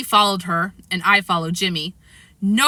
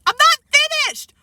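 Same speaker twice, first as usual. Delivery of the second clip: high, loud protesting shout; Fudge; 6 dB louder than usual. A narrator's reading. Fudge refusing to be hushed at breakfast, shouting. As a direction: shout it high like an indignant kid. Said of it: "Fudge shouted".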